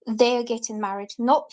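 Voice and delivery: ordinary talk, nothing marked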